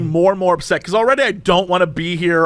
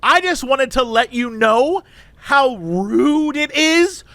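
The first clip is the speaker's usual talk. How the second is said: drawn-out, indignant lecture, pitched up; full Karen; 5 semitones higher, slower than usual. Retold or imitated. Woman lecturing cashier about wasted time, indignant. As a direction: imitate an outraged customer scolding slowly. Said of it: "she's going full Karen".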